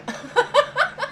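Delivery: high-pitched nerd giggle; nerdy laugh. Acted. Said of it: "This is my nerd voice here".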